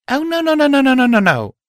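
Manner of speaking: high, loud rush of no's; frantic, high-pitched panic; panicked denial. Acted. Narrator dreading a memory to himself, panicked.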